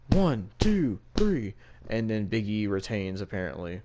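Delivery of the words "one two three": mock referee counting the pin, louder and higher. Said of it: "The referee just goes".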